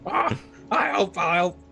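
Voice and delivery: high-pitched character fretting; put-on high voice, talking to himself; muttering to himself. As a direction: dither aloud in a high character voice. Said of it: "Oz will mutter to himself".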